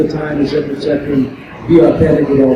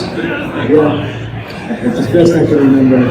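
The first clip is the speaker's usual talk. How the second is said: normal talking voice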